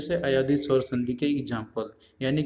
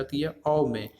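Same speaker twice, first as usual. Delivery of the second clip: ordinary delivery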